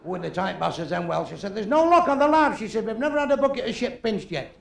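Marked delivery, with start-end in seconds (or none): 1.5-4.5 lightly voiced wife. Wife's quoted lines.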